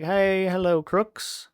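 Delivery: high, drawn-out friendly greeting; Lenny's cheery hello. Retold or imitated. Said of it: "he's like".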